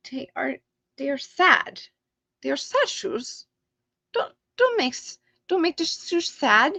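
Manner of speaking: high, whiny little pleading voice; sad shoes voice. Pretend. Streamer voicing the unclaimed shoes to the winner, mock whine. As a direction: play the shoes begging not to be made sad.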